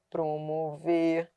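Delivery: "promover" slow, soft and low, closed first o; weak prefix demo. Shown as a demonstration. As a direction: draw out "promover" softly with a weak prô. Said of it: "da aglutinação de pro, fraco, átono".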